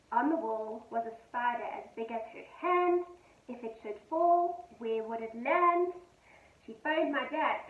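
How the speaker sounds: gentle sing-song poem reading; poem read to children. A narrator's reading. Teacher reading a poem to her pupils, gentle and sing-song.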